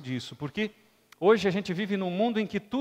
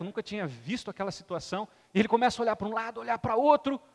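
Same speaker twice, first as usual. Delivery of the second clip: usual speaking voice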